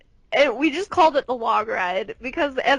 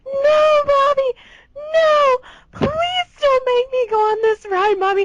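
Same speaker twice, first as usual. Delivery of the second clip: put-on high, wailing child pleading to mommy; crying little boy; 12 semitones higher, 3 dB louder than usual. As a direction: imitate a sobbing kid begging not to go. Little brother begging in line.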